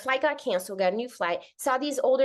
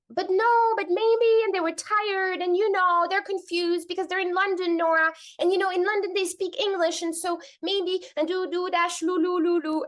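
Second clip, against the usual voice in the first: high, rushing string of justifications; hammed-up high-pitched mother explaining away; mock excuse-making; 8 semitones higher than usual. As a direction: babble defensive excuses, trailing into nonsense syllables. Parodies mother's past excuses.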